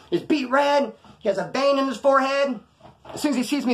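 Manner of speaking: ordinary talk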